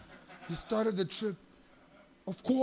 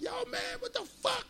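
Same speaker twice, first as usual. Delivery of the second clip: very high-pitched complaining imitation; mock loud New Yorker; 3 dB quieter than usual. Imitating yelling New Yorkers, high mock outrage. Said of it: "think New Yorkers are really loud".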